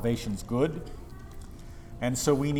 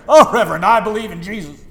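mock smug believer, louder; smug churchgoer; 6 semitones higher, 12 dB louder than usual. Pastor mimics smug congregants talking to him, mocking. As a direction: sneer it like a self-satisfied member. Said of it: "smug decadence of those who declare".

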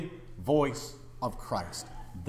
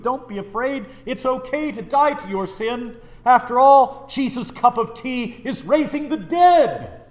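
high, forceful pulpit exhortation; impassioned preaching; 8 semitones higher, 8 dB louder than usual. Real, not acted. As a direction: urge the sinners with raised, emphatic pleading. Preacher urges congregation at full force.

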